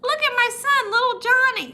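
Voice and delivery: high, sing-out mock mom voice; imitating the lady. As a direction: put on a high-pitched proud mother. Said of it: "This is how she was talking".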